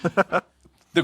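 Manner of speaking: high-pitched comic movie quote; Blazing Saddles parody. Acted. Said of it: "I actually think of more Blazing Saddles".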